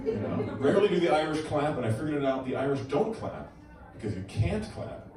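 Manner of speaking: ordinary talk, nothing marked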